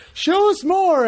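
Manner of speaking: the audience yelling for another bit; mock crowd demand. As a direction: shout it like an eager crowd.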